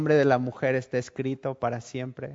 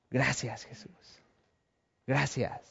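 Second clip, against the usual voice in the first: breathless, much softer gratitude fading to a whisper; whispered thanks; 2 semitones lower, 10 dB quieter, slower than usual. Acted. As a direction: whisper your thanks, face to the ground. Woman prostrate giving thanks.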